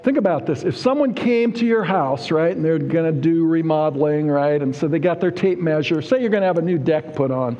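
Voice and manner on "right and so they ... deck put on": ordinary talk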